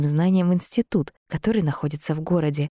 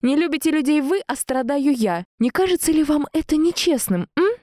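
high, indignant challenge; renewed anger; 11 semitones higher than usual. A narrator's reading. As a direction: protest the unfairness, pitch held high. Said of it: "Вновь злость окутывает меня новой волной".

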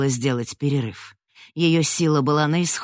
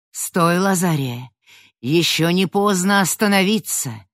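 amplified commanding call; 4 semitones higher, 5 dB louder than usual. Acted. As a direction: call out with magical force. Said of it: "прозвучал её голос, усиленный в несколько раз".

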